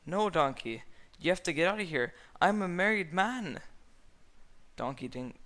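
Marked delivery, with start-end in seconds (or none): 0.1-3.6 lightly voiced Shrek protesting. A narrator's reading. Shrek refusing Donkey.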